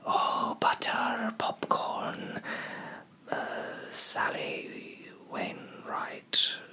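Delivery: breathy whispered spell, high and hushed; elvish incantation. Acted. Elf woman chasing the rascals away, whispered incantation. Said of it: "a elvish incantation".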